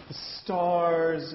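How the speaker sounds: lifted, open the stars; lightly grand; expansive. Shown as a demonstration. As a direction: reach out toward the stars. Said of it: "it wouldn't make sense".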